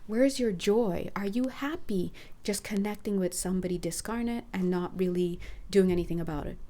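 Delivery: ordinary delivery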